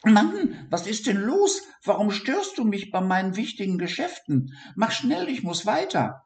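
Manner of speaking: very high, out of breath and impatient; breathless high mouse annoyed at being stopped; panting mouse. A narrator's reading. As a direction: gasp it between breaths.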